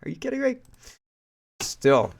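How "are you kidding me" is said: incredulous disbelief. Real, not acted. Outraged disbelief at allegation.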